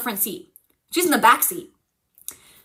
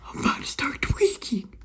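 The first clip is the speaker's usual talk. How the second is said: a breathy, hushed aside; whisper; 6 dB quieter than usual. Real, not acted. Telling viewers the story is getting to her, whispering. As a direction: whisper it like you're spooked. Aside while retelling scary part.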